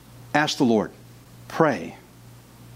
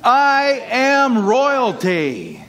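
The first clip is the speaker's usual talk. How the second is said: higher, louder, very slow joyful declaration; happy about it; 8 semitones higher, 3 dB louder, much slower than usual. Shown as a demonstration. Preacher showing the congregation how to say it happily, joyful proclaiming. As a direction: proclaim "I am royalty" beaming with joy, each word stretched out. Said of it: "Say it like you're happy about it".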